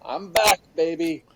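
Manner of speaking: high, hyped-up comeback holler; retold triumphant yell. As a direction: imitate yourself rallying the sick troops. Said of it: "I'd always yell".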